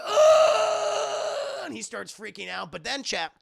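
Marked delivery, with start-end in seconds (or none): 0.1-1.6 very high, loud gagging shout; disgusted yell. Retold or imitated. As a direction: yelp as the shrimp hit his face. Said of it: "He's like".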